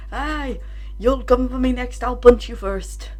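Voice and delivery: a hammy but light Irish brogue; bad Irish brawler voice; terrible Irish accent. Voicing the circling Irish brothers, bad Irish accent. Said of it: "ignore my terrible Irish accent".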